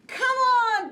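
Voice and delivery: high, loud eager call. Acted. Bear calling Mole to come fly kites, high and loud. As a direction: call your friend over excitedly.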